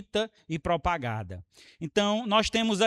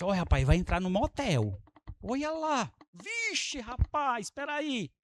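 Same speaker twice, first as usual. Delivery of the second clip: hushed spying; 3 semitones higher, 6 dB quieter than usual. Retold or imitated. Man spying from behind a post.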